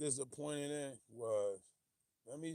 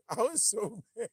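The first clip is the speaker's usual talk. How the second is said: laughing; 9 semitones higher, 7 dB louder than usual. Real, not acted. Laughing about the lost fight.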